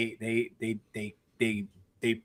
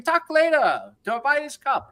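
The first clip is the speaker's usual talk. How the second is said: put-on much higher, curt Chinese-accented brush-off; mock Chinese accent; 13 semitones higher, 4 dB louder than usual. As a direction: wave the customer off in a hammed-up Chinese accent. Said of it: "I don't wanna do the accent".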